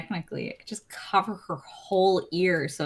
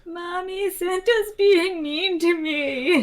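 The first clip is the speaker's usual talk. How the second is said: put-on high, slow tattling kid voice; whiny child; 11 semitones higher, slower than usual. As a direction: complain to mommy like a little kid. Child tattles on mean Santa.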